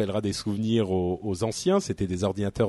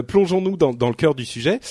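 ordinary delivery

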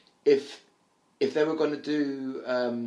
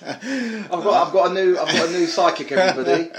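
loud, high presenter build-up; mock grand reveal; excited announcement; 7 semitones higher, 8 dB louder than usual. Real, not acted. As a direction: stammer out big news to the audience. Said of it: "mock announcement to listeners".

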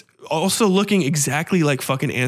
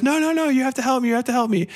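frantic pleading; 9 semitones higher, much faster than usual. Retold or imitated. Man begging Tessa.

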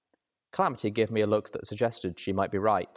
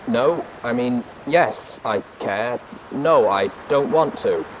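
loud, raised robotic voice, flustered; tinny artificial voice; 5 semitones higher, 8 dB louder, slower than usual. A narrator's reading. Watcher admitting concern for the ponies, flustered artificial voice. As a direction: stammer out a correction as the machine. Cornered Watcher answers through the bot.

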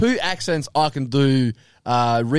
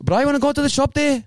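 high, chatty coloured South African accent; 11 semitones higher than usual. Shown as a demonstration. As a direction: greet a mate in coloured South African English. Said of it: "that's the South African accent".

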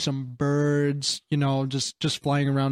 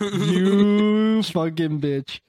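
louder, slow, stretched curse; hammed-up indignant yell; drawn-out mock outrage; 4 semitones higher, 3 dB louder, much slower than usual. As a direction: draw out the insult in fake fury.

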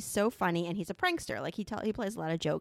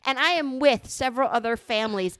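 retold indignant protest, pushed louder; annoyed and angry; 3 semitones higher, 6 dB louder than usual. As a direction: argue it emphatically, retelling your annoyance. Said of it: "I'm getting really fucking annoyed and really angry".

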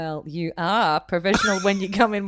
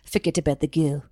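lower, put-on South African accent; 4 semitones lower than usual. Host quotes the famous film line, South African accent. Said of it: "the same South African line".

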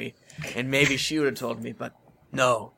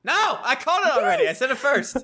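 high, loud squabbling with a laugh; childish protest; 10 semitones higher, 3 dB louder than usual. Acted. Player squabbles over who goes first, high childish protest. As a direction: insist on dibs, pitched way up.

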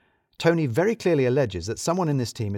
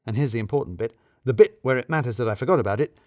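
ordinary delivery

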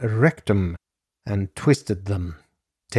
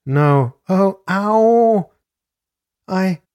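high cries of pain, drawn out on "ow"; acted scream; 9 semitones higher, 5 dB louder, slower than usual. A narrator's reading. Narrator voices victim's pain, acted high scream.

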